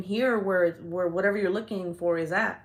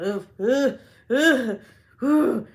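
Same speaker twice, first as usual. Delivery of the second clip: repeated loud ughs, rising in pitch; disgusted shudder; 4 semitones higher, 6 dB louder, much slower than usual. Real, not acted. Recalling spiders on the ground, loud disgusted ughs. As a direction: shudder it out in separate bursts.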